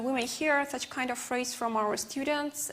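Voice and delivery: ordinary talk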